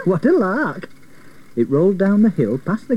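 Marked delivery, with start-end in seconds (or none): 0.0-0.9 higher, gleeful exclamation; excited cry. A narrator's reading. Cyril enjoying the chase, crying out gleefully. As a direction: cry out in delight. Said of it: "Cyril cried".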